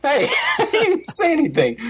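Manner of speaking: high-pitched, amused objection; squeaky, laughing self-defence; laughing protest. Real, not acted. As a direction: protest innocence through a laugh. Laughing protest at listener's jibe.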